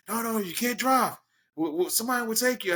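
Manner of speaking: high-pitched, urgent objection; worried protest. Retold or imitated. Colleagues stop injured wrestler from driving, high worried protest. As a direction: imitate someone hurriedly objecting, pitch up high.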